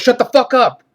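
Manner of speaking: exasperated retold yell; angry outburst. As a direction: shout it to shut the jokers down. Retells Arnold silencing the fart jokers.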